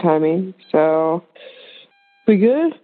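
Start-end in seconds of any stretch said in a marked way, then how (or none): 2.3-2.7 yawning. Real, not acted. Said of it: "Second yawn".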